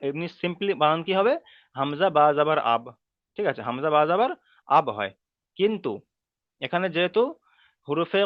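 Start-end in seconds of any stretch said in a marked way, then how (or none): none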